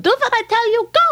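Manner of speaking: high commanding push; insistent rabbi. Acted. Rabbi ordering the poor man off, high and firm. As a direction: shoo him off firmly.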